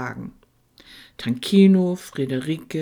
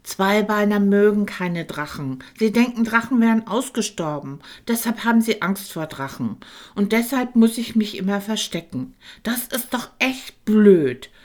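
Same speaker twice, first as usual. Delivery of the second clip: higher, sulky grumbling dragon; 8 semitones higher, 6 dB louder than usual. A narrator's reading. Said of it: "maulte Tankino". (Tankino mault trotzig über die Menschen)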